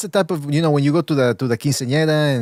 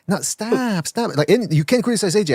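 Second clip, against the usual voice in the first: whiny; 6 semitones higher than usual. Retold or imitated. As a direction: mimic whiny fans pleading. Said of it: "a little bit more whiny".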